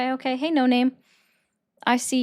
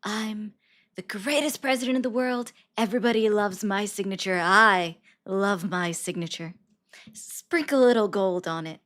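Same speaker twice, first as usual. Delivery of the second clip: Trump impression; 4 dB louder than usual. Streamer doing Trump boasts again.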